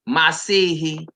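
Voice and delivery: Masihi drawn out slowly, syllable by syllable; teacher leading a call-and-response on Masihi; slow teaching pronunciation. Shown as a demonstration. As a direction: model Masihi slowly for the group. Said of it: "Say it with me".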